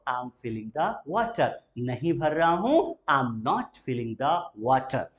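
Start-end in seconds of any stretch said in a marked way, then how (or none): 0.1-1.5 slow and soft, each word separate; slow model answer. Shown as a demonstration. Teacher models the answer for students to repeat, slow and soft. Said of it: "बोलते चलेंगे please". 3.1-5.0 very slow, not stressed; slow model answer. Shown as a demonstration. Teacher drills the negative answer, very slow. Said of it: "बोलते चलेंगे please".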